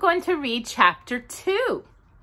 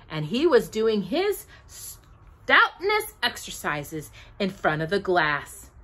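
plain reading voice

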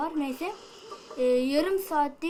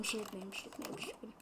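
much softer, muttered chant of mine; muttered to self; 4 semitones lower, 17 dB quieter, much faster than usual. Real, not acted. Gamer grabbing the strawberries, muttering mine mine. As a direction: mumble it under your breath.

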